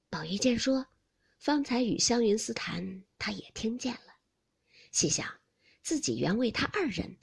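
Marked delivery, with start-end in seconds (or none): none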